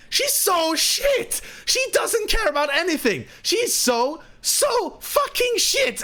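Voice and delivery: put-on very high, drawn-out Latvian-accented outrage; Miralyn's indignant rant. Latvian guest ranting among dining families, high accented outrage. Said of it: "he's very loud".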